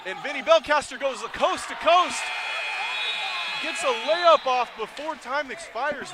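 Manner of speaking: excited yelling commentary; shouting play-by-play. Real, not acted. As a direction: shout the call, high and loud. Coast-to-coast final-second layup.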